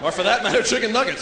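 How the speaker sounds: normal talking voice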